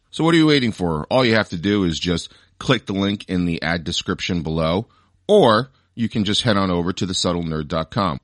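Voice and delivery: loud, punchy sponsor read; podcast ad read. Acted. Host sells nerd merch to loyal listeners, upbeat ad read. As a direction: pitch it with emphatic, salesy drive.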